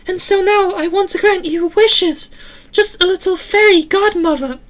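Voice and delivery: very high tinny falsetto; Eleanor, metallic but kind. A narrator's reading. Eleanor thanking Sarah for saving her, kind tinny voice. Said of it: "while metallic, also sounded kind".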